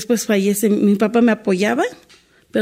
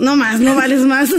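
said through a laugh; a real chuckle running through the words; 2 semitones higher than usual. Real, not acted. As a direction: laugh it out, shrugging off the pain. Laughing at her own resolve.

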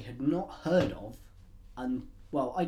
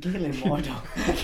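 "Galen Mordo" slow, in a jokey put-on Irish accent; slow hammed-up Irish accent; mock Irish accent; 4 dB louder, much slower than usual. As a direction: drag the name out in a bad Irish accent. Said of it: "Or Irish".

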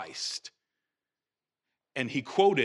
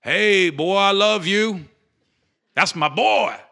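loud, higher-pitched proud-dad holler; mock God on microphone; 6 semitones higher, 10 dB louder, slower than usual. Preacher parodies God grabbing the microphone, mock booming cheer. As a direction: boom it out like a hyped-up announcer, in jest. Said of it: "it wasn't God grabbing the microphone".

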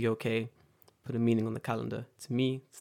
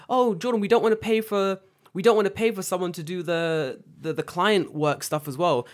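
a hint of a wheedling boss, higher pitched; lightly mocking, raised-pitch excuse; mimicked boss; 6 semitones higher, 5 dB louder than usual. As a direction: imitate the boss making excuses.

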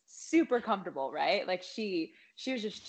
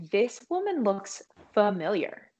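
slow, deliberate inner realization; dawning recognition; 6 dB louder, slower than usual. Retold or imitated. Retelling how she began to recognize the woman, slow dawning recognition. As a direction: draw it out as it dawns on you, firmly.

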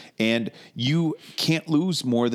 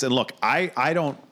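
usual speaking voice

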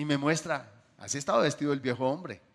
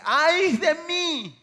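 a long, slow, loud wail pitched much higher; prophet's anguished cry; 12 semitones higher, 9 dB louder, much slower than usual. Acted. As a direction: cry it out as if struck down before God's glory. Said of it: "dice que cae como muerto y dice".

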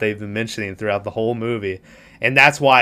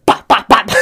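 loud, punchy popping noises; sharp vocal gunshot pops; imitated gunshots; 7 dB louder than usual. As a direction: fire off mock gunshots with your mouth.

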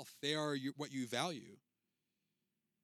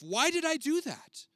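much higher and louder, slow; exasperated self-reproach; 13 semitones higher, 9 dB louder than usual. Retold or imitated. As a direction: cry it out at yourself in despair. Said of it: "How often have you said that to yourself?".

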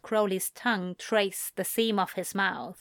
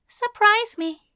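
high, louder playful reply; playful lover; 13 semitones higher, 7 dB louder than usual. A narrator's reading. Aziraphale daring Crowley to pick the place, playful high voice. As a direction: throw it out high and bold.

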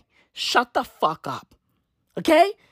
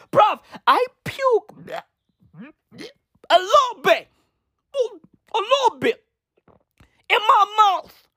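mock disgust; 10 semitones higher than usual. Telling viewers about Gen Z complaints, in a high, hammed-up gagging voice.

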